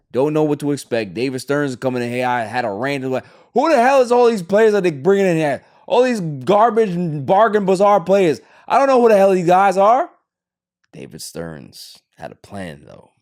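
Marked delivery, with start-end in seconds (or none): 3.5-10.1 mock baffled fan. Voicing a baffled, angry fan.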